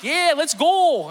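high, loud, drawn-out Wisconsin cheer; Northern fans' cheer. Retold or imitated. Wisconsin classmates cheering back, imitated Northern accent. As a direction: holler back with hammed-up Northern vowels. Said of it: "they'd all look at me and like".

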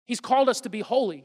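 ordinary talk, nothing marked

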